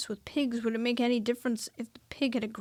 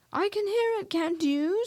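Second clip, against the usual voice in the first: high, anxious little voice; 9 semitones higher, 4 dB louder than usual. A narrator's reading. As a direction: ask in nervous fear. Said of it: "said Piglet anxiously".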